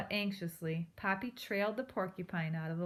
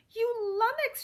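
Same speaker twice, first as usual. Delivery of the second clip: much higher, louder furious squeal; furious cry; 7 dB louder than usual. A narrator's reading. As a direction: pound him with the insult. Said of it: "she cried".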